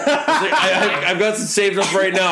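high, loud and laughing through the words; giggly eager quote. Retold or imitated. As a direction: impersonate a giddy guest who can't stop laughing. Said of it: "he's like".

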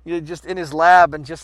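normal talking voice

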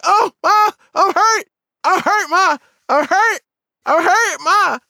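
high, put-on wail of pain; faking hurt. Kid faking injury to mom after the crash, high fake-hurt wail. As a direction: cry out to Mom, hammed-up and pleading. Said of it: "just act like you hurt".

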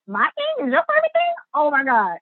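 high, delighted gasp of disbelief; excited disbelief. Retold or imitated. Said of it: "And I'm like".

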